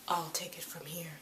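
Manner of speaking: soft secretive whisper; hushed mock whisper. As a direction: breathe it like a sly aside. Yellow Pearl takes over the call.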